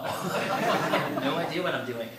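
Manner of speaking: amused and helpless; laughing. Real, not acted. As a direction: laugh through the confession. Laughing at his own confusion.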